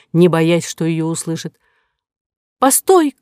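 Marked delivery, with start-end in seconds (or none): 2.6-3.2 high, loud cry; shouting. Acted. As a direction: call out to stop her. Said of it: "крикнула Кора".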